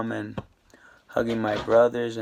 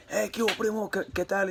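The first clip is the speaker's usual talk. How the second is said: put-on higher, softer Mexican-accented greeting; Mexican cellmate voice; 7 semitones higher, 7 dB quieter than usual. Mexican cellmate greeting him again in a higher, softer Mexican accent. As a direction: pitch up and ease off into a Mexican accent. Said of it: "you know how Mexicans, they talk".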